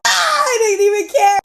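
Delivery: high-pitched mock cry, then breezy confession; gleeful outburst. Storyteller confessing no concern for fallen cousin, gleeful outburst. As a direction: blurt it out high, gleefully unbothered. Excited punchline of childhood story.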